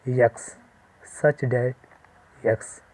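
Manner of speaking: very slow, drawn-out dictation. Shown as a demonstration. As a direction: dictate the notation slowly. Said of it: "तो ऐसे लिखेंगे".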